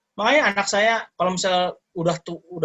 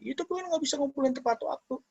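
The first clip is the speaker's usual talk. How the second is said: high, soft mock-crying complaint; tearful child imitation; 10 semitones higher, 6 dB quieter than usual. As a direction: imitate a child whimpering to a parent. (menirukan anak menangis mengadu)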